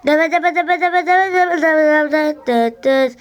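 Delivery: sung bell imitation. Imitating school bell ringing.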